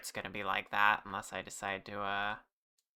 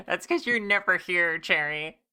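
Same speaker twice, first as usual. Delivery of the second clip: teasing cutesy voice; 5 semitones higher, 5 dB louder than usual. Acted. Teasing a chatter by name.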